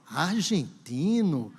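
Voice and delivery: drawn-out, theatrical query; theatrical official. Acted. Immigration officer checking Alejandro's passport, hammed-up and drawn out. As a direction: stretch ARGENTINO slowly, hammed-up. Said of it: "eu conto a história assim".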